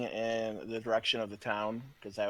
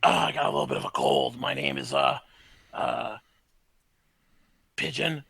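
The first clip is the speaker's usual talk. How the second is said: talking animal; 5 dB louder than usual. Acted. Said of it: "you got your voices on".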